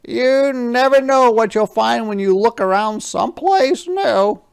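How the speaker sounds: a big friendly truck, sorry and apologetic; Yellow Truck's voice. Acted. Yellow Truck apologises to Little Red in a high, loud voice. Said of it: "said Yellow Truck".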